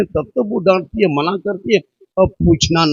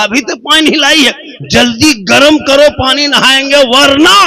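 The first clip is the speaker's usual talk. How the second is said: mock scolding husband; 7 semitones higher, 3 dB louder than usual. Husband scolding wife for water.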